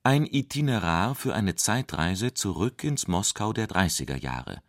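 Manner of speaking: usual speaking voice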